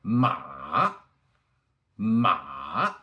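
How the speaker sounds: MA dipping down, then running back up; long dip-and-rise MA; third tone. Shown as a demonstration. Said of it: "And the third tone".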